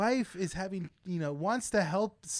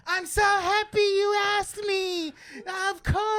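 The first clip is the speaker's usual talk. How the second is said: high, slow, breathy-sweet wife voice; mock wife voice; 13 semitones higher, 5 dB louder, slower than usual. Retelling the show, mimicking the wife's delight in a high voice. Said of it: "She's like".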